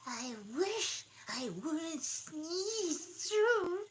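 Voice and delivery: very high, slow squeaky voice; dormouse voice. Acted. Dormouse complaining to Alice, high and slow. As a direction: stretch it out like a tiny creature. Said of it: "said the dormouse".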